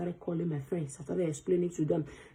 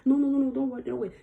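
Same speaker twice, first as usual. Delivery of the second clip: high, loud panicked no's; freaking out; 7 semitones higher, 5 dB louder, faster than usual. Retold or imitated. Father and son panicking at a police threat. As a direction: blurt it out in a panic to calm him down. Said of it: "Both of them started freaking out".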